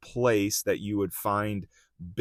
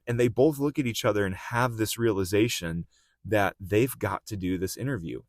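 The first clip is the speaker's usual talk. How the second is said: usual speaking voice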